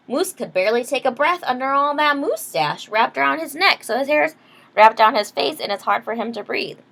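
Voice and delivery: plain reading voice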